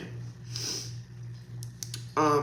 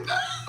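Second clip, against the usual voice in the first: mock scream; 8 dB quieter than usual. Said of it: "And I was like".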